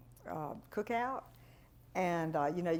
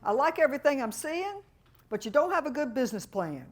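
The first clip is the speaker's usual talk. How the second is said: louder, higher, hearty voice of a car dealer; folksy dealer verdict; 7 semitones higher, 7 dB louder than usual. Retold or imitated. Car dealer tells the consultant her plan falls short, hearty and loud. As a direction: boom it out like a friendly dealer. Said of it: "He said".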